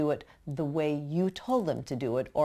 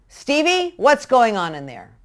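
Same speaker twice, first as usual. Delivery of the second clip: higher, louder sharp call of the name; accusing shout; 7 semitones higher, 9 dB louder than usual. Shown as a demonstration. Parent yells a child's name from the other room. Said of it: "As opposed to".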